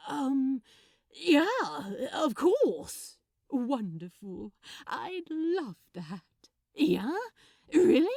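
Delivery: loud, high and delighted; can't believe his luck; breathless, eager yes; giddy Crowley. Acted.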